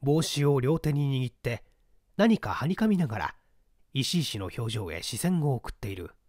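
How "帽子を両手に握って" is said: usual speaking voice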